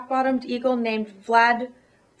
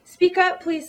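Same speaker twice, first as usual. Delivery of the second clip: higher, louder call to a faint voice; 7 semitones higher, 3 dB louder than usual. Acted. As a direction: call down to the tiny voice, raised and high. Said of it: "voice was so faint".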